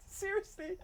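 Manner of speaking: high, soft laughing seriously; breathy laughing seriously; laughing disbelief. Real, not acted. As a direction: giggle it out in disbelief. Laughs at unfollow.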